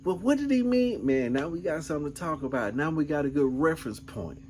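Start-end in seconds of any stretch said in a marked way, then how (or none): 0.1-1.1 high questioning wife. Retold or imitated. Wife's question voiced.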